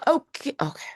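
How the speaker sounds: high, put-on South African accent; a self-conscious try at a South African accent; hesitant mock South African accent; South African accent attempt. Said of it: "I'm gonna butcher the accent".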